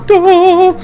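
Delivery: high drawn-out mocking "duh". Host mocking his own obvious fact about Deadpool, mock duh. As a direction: stretch it out high, playing dumb.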